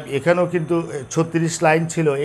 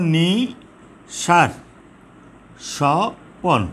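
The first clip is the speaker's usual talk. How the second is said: slow, syllables pulled apart; drawn-out metre scanning; slow syllable split; much slower than usual. Shown as a demonstration. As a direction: sound out each syllable for counting. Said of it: "তাহলে এখানে এক".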